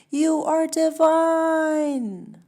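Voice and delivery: very high, slow, booming call; big high divine proclamation; God yelling from a mountaintop. Acted. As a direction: shout it from the peak, stretched out. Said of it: "God stands on a mountaintop and yells".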